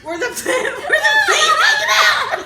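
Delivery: high, loud, slow question broken by laughter; cracking up while asking it; laughing question. Real, not acted. Dispatcher retells asking the caller, laughing hard.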